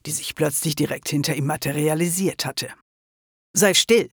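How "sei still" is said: a commanding order, slightly higher; befehlend. A narrator's reading. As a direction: order someone sharply to be silent. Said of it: "befahl die Kugel". (im Befehlston)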